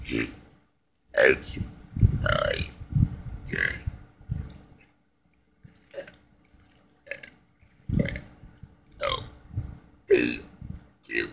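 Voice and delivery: burp the alphabet letter by letter; burping the words. Shown as a demonstration. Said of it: "now we gotta burp the A B Cs".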